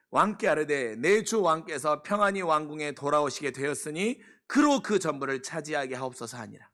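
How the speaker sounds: scripture reading. Acted. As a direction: read the verse with weight. Reads scripture aloud. (성경 구절을 장엄하게 낭독)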